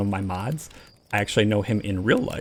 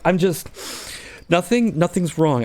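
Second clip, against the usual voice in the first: higher, slower, flustered insistence; nervous denial; 6 semitones higher than usual. Retold or imitated. Friend in the story hiding fear of space, flustered. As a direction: cover your fear badly.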